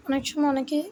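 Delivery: ordinary talk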